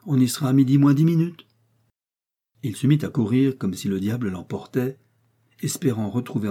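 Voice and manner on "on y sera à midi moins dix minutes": louder, raised call; shouted back. A narrator's reading. D'Artagnan shouting back to Athos as he runs. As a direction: call it back while running off. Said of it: "lui cria D'Artagnan".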